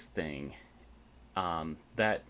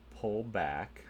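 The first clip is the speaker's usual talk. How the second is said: ordinary talk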